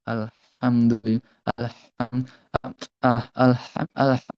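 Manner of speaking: slow আলহামদুলিল্লাহ, cut off and restarted; restarting recitation. Shown as a demonstration. Teacher shows how he struggled in prayer, halting restarts. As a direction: keep breaking off at আলহাম- and starting over. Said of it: "এরকম করতে করতে করতে করতে".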